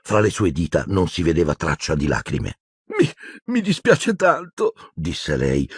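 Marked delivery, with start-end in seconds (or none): 3.0-4.7 put-on high, sobbing girl's apology; tearful stammer. A narrator's reading. Hermione feigning tears to her friends, high sobbing stammer. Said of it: "singhiozzasse disperata".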